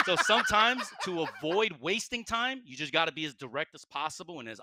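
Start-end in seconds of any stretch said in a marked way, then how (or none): 0.1-2.6 laughing, slowed. Real, not acted. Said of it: "Just like I don't have the time here".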